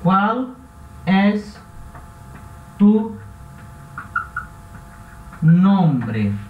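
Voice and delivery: words spaced far apart; slow dictation. Shown as a demonstration. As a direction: read the Spanish example slowly, word by word. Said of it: "por exemplo, aqui, ó".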